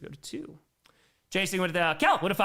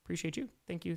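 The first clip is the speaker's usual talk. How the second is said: ordinary talk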